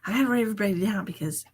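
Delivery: ordinary delivery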